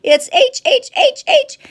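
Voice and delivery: very high, loud stuttering squeal; helpless acted laughter. A narrator's reading. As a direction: splutter it out in pretend hysterics. Rhinoceros can't speak for laughing.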